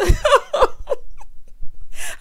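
big high cackling laugh; a real, loud, high laugh. Real, not acted. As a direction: burst out laughing. Laughing at his sleepy eyes.

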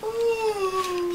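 high drawn-out yawn. Real, not acted. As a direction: yawn loudly. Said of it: "You're making me yawn!".

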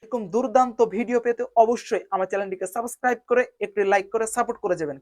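ordinary delivery

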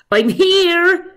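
high, loud call across the water; shouting. Acted. Patrick calling the snake at Loch Ness, loud high shout. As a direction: call out as loud as you can. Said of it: "he shouted at the top of his lungs".

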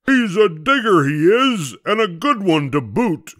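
high, loud pirate captain; storybook pirate shout; pirate yell. A narrator's reading. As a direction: yell it back to the crew. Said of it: "he yelled back to his crew".

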